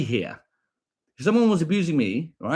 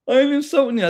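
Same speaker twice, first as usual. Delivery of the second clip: mocking commenter; 10 semitones higher, 4 dB louder than usual. Parroting the commenter again.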